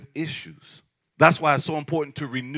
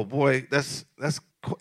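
normal talking voice

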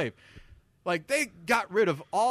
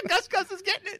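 laughing squeal; 4 dB louder than usual. Retold or imitated. Mock reaction to movie scene.

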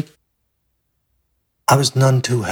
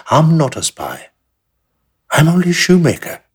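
louder and higher, insisting; emphatic protest; 7 semitones higher, 5 dB louder than usual. Acted. Shoemaker protesting to the German officer, loud and insistent. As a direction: push your innocence hard, rising to the end. Accused man protests innocence.

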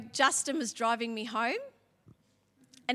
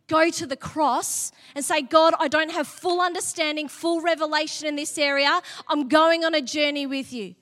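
louder, rising passionate sermon; loud impassioned prayer call; fervent preaching; 6 dB louder than usual. Acted. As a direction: preach it out with full conviction. Preaching at full force.

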